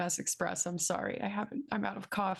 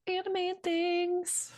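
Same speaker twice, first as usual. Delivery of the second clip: "Anime and Things" drawn out slowly, pitched up high; hyped-up announcement; 11 semitones higher, slower than usual. Shown as a demonstration. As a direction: announce it like a big reveal. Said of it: "announces sponsor name".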